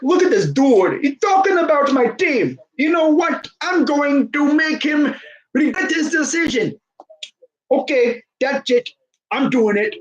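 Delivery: high-pitched, indignant put-on executive voice; Masai Ujiri. Team boss reacting to a streamer, mock indignant. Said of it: "Masai Ujiri was watching my stream. He's like".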